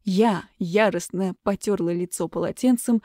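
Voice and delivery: plain reading voice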